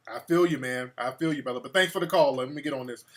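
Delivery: normal talking voice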